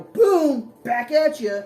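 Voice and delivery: loud, punchy spell callout; excited shout. Acted. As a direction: fire it off with gusto. Spell cast with gusto.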